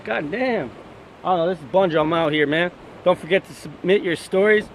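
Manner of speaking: ordinary talk, nothing marked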